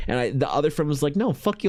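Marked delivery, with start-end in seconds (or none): 1.2-1.7 high, sharp mock brush-off; mock defiant refusal. Friend refusing to watch Tron, high mock defiance.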